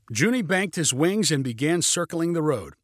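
plain reading voice

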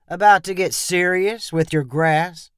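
neighborly but judging; friendly but judgmental. A narrator's reading. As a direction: friendly question with a judging edge. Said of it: "in a friendly but judgmental tone".

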